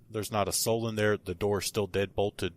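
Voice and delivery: ordinary talk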